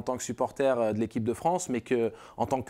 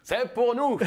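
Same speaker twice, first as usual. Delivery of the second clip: fan excitement; 10 semitones higher, slower than usual. Retold or imitated. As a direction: relive the hype as a hopeful supporter. Fan retells hopeful 2006 final.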